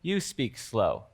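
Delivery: slow and soft, drawing the words out; slow speech. Shown as a demonstration. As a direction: demonstrate speaking slowly. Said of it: "If they're speaking slow".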